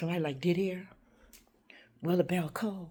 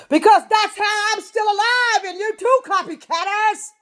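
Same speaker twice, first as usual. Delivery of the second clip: loud, high, slow shout; defiant shout; 11 semitones higher, 12 dB louder, slower than usual. Real, not acted. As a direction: hurl it at the copycats.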